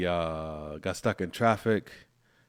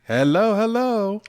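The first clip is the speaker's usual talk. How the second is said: cheery greeting; 12 semitones higher, 9 dB louder than usual. Real, not acted.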